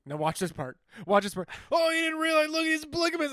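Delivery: building from calm to a high shout; overexcited. Retold or imitated. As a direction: hype it up until shouting. Acted overeager viewer building up.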